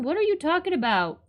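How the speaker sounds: ordinary talk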